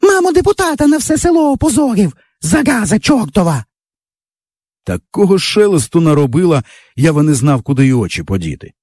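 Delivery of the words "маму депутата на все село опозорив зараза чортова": put-on an outraged little girl who can't say R; lisping little girl. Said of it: "Вона букву «Р» не вимовляє". (дитячий голосок без «р»)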